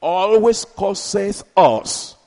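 always drawn out slowly; slow preaching. Acted. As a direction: savour the word for the crowd.

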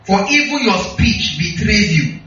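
ordinary delivery

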